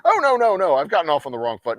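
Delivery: high, loud mock backpedal. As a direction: squeal out a fake apology.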